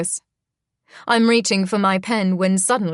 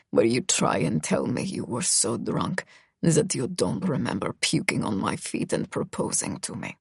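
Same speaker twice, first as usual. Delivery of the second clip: Misha, low and cold, almost murmured; dropped low, a menacing murmur; low male voice; 7 semitones lower, 7 dB quieter than usual. Acted. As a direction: voice the brooding boy low and quiet.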